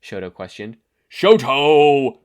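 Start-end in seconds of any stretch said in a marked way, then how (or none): 1.1-2.2 sharp, much louder yell of a name. A narrator's reading. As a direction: bark the name in anger. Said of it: "he yelled".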